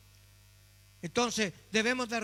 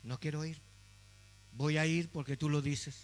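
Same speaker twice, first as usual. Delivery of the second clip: reluctant; 5 semitones lower, 4 dB quieter than usual. Retold or imitated. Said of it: "sin ganas".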